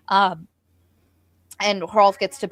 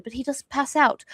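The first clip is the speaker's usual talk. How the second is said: normal talking voice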